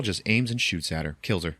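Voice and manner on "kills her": ordinary talk, nothing marked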